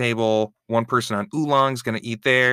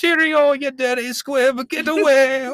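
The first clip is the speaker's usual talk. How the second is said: very high, loud hammed-up posh British squeal; posh English; 4 dB louder than usual. Trying to prove he can talk posh, a squealed British accent. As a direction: squeal like an outraged English dandy. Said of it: "you can't talk posh".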